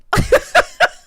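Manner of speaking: loud high-pitched cackle. Real, not acted. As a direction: burst out in a shrieking laugh. Laughing at own Latrice bit.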